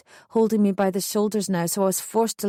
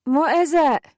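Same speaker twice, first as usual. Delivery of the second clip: higher mother's call from downstairs; raised, questioning call; 6 semitones higher, 4 dB louder than usual. A narrator's reading. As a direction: call up the stairs. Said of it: "Mum called out from downstairs".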